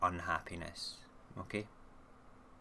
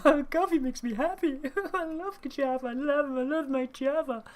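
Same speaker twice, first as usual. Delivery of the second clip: mock gushing, much higher and louder, laughing; 9 dB louder than usual. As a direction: ham up a coffee lover gushing while laughing. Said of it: "But I'm not going around going".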